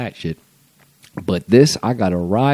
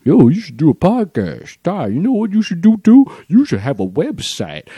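mocking fans; 9 semitones higher than usual. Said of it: "for you to be asking me".

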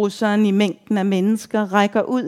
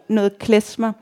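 ordinary delivery

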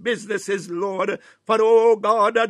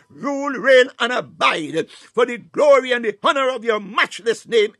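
ordinary talk, nothing marked

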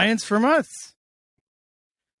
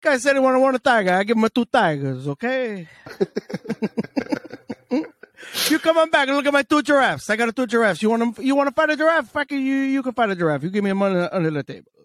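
fast, high hustler pitch; pushy street vendor; 6 semitones higher, much faster than usual. Pretend. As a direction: sell it like a shady street vendor. Continues the vendor bit.

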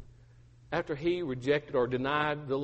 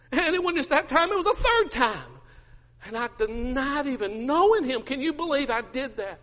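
high, wailing-style confession; mounting anguish; 9 semitones higher than usual. Acted. Peter admitting the third denial, increasingly anguished. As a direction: swell from hushed to distraught. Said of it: "Peter just begins to weep".

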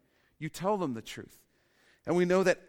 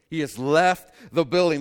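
loud emphatic repeat; 2 semitones higher, 10 dB louder than usual. Shown as a demonstration. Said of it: "repeated louder".

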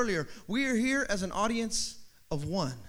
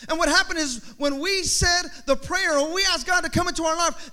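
usual speaking voice

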